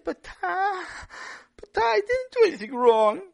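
put-on child voice explaining himself; little boy. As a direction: play a young boy insisting he did nothing wrong. Boy protests to his father.

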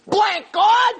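very high shouted cry; screaming. Retold or imitated. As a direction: cry it out with fire. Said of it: "the same fire that she screamed".

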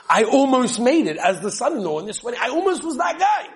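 high insistent protest; mild mock plea; pleading protest. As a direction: insist you nearly made it. Poor guy protesting.

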